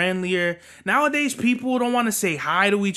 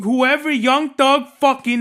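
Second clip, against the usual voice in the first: loud, higher cursing out; angry curse; 5 semitones higher, 5 dB louder than usual. Real, not acted. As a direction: throw it at them. Cursing the gossip subject.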